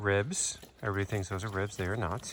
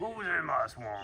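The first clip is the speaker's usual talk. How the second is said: mock Shrek growl; 5 semitones higher, 7 dB quieter than usual. Repeats ogre joke at pig.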